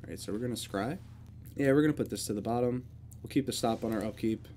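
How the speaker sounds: ordinary talk, nothing marked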